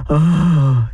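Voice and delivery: mock horrified "oh" on a gasp. Shown as a demonstration. Author imitating an audience's reaction, horrified gasp. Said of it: "horrified sound".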